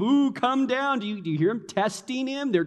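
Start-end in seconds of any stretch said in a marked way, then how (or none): 0.0-1.0 mocking taunt. Acting out crowd's taunt.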